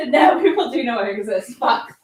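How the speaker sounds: loud laughing panic. Real, not acted. Realizing people now know she exists, loud laughing dismay. Realizes she's been exposed.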